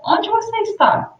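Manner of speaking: high rising question pitch; question tune. Shown as a demonstration. Teacher demonstrating a question to students. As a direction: ask it clearly as a question. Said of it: "é uma pergunta".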